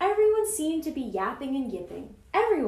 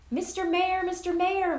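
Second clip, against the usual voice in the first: higher, urgent call; 7 semitones higher than usual. A narrator's reading. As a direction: call to someone far off. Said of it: "Horton called".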